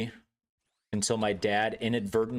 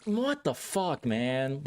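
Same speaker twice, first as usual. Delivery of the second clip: hurt disbelief; 5 semitones higher, much slower than usual. Retold or imitated. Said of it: "but I'm just like".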